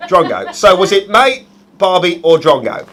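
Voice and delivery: broad, put-on Aussie accent; Australian accent. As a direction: ham up the Australian answer options. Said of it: "That's enough offending every Australian person".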